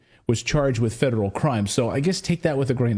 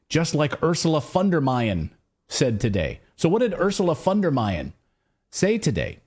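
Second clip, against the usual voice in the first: ordinary talk, nothing marked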